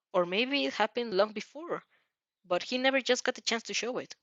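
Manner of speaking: usual speaking voice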